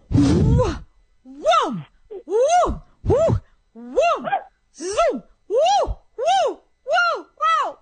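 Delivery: high whooping calls; joyful high-pitched whoops; mojo whoops. Real, not acted. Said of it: "I'm gonna give a mojo".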